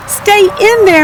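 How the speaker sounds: a high, loud shout at the chicks. Acted. Farmer shouting at unhatched chicks, high loud panicked shout. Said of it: "Missus Pennyworth shouted".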